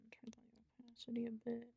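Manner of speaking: whispering. Real, not acted. Whispering while drawing.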